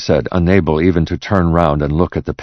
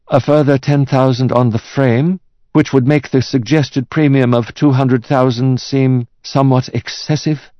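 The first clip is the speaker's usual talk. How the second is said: higher-pitched appraiser's voice; cautious art expert; 9 semitones higher than usual. Acted. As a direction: value it carefully, like an expert. Expert values the painting.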